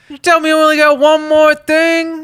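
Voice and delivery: very high, loud hammed-up outrage; mock outrage. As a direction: squeal it in fake disbelief.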